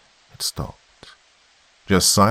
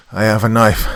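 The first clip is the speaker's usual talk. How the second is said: loud, higher desperate threat; threatening; 5 semitones higher, 9 dB louder than usual. A narrator's reading. Cornered commander warning Zudov about the knife, threatening. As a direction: threaten them, voice raised hard. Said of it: "I threatened".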